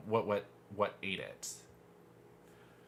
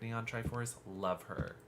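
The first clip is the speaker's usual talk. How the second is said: ordinary talk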